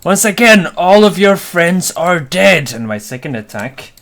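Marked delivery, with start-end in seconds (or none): 0.0-2.7 raised, higher battle taunt; loud exclamation. Acted. Player voices his fighter taunting the enemy while striking, exclaiming loudly. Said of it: "while exclaiming loudly".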